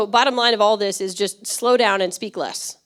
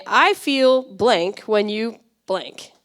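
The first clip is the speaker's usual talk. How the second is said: slow template read; much slower than usual. Shown as a demonstration. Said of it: "here's the template".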